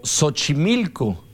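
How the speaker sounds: «Xochimilco» con S inicial, muy lento; X como S. Shown as a demonstration. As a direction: say «Xochimilco» slowly, starting with a plain S. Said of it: "se está utilizando la X como S". («Xochimilco» pronunciado «Sochimilco», alargado)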